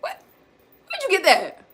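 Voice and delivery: high and loud, caught him; shocked mother catching her son; startled mom. Retold or imitated. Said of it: "I said".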